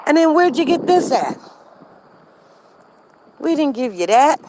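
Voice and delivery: lightly voiced child demanding answers; nosy child. Neighbor's daughter questioning a playmate about toys, mock indignant kid. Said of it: "daughter, asked my daughter".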